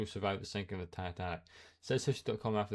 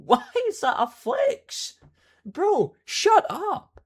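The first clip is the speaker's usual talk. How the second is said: high, loud, slow disbelieving shout; incredulous yell; 12 dB louder, much slower than usual. Real, not acted. Mocking the flex, shouting at the commenter in disbelief. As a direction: yell it like they can't believe it.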